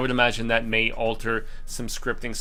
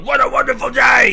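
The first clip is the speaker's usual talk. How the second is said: high, loud, frenzied shout; ecstatic war cry; 11 semitones higher, 10 dB louder than usual. Retold or imitated. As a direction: yell it like a crazed desert warrior.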